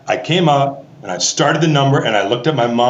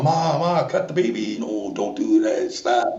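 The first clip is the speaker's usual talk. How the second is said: hushed pleading; 2 semitones lower, 5 dB quieter, slower than usual. Retold or imitated. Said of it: "trying to lean back going".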